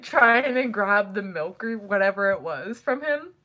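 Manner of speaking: ordinary talk, nothing marked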